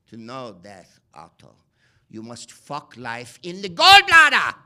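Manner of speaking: big hammed-up mad scientist proclamation; Udo Kier as Frankenstein. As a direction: roar it like a deranged European doctor. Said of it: "And Udo Kier says in the movie".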